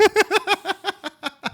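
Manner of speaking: high, startled burst of laughter; a sudden high laugh at a wild take; shocked laugh. Real, not acted. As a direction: laugh out in disbelief. Shocked reaction to friend's claim.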